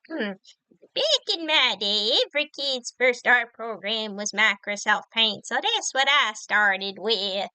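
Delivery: put-on slow creaky old-timer; old person voice. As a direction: play a slow old grandpa. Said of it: "Old person voice".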